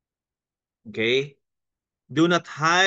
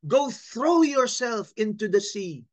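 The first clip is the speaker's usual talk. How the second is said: higher, slow, stressed reading of "Go throw yourself into the sea."; with feeling and emphasis; 6 semitones higher than usual. Shown as a demonstration. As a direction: lean on "throw", slowly, with feeling. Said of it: "medyo diinan mo ng konti". (binigyang-diin ang "throw" nang mabagal)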